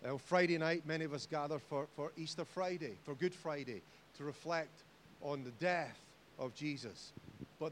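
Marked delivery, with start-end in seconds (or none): none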